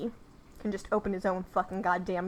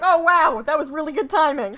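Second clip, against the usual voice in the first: mock surprised; 7 semitones higher, 11 dB louder than usual. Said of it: "they're like".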